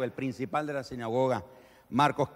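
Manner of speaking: ordinary talk, nothing marked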